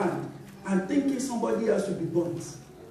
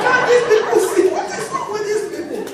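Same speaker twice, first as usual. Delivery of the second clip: high, loud, laughing exasperation; retold exasperation; 10 semitones higher, 5 dB louder than usual. Wife offered food while still angry, high loud disbelief. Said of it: "I said".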